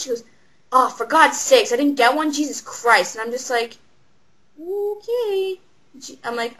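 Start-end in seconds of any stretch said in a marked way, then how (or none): 0.7-3.2 mock outburst of indignation, stretched at the start; exasperated customer. 4.6-5.6 high, drawn-out reaction; taken-aback okay. Retold or imitated. Taken aback by customer's outburst.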